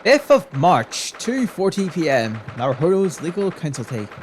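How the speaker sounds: scene heading read-out. A narrator's reading. Scene time and place heading.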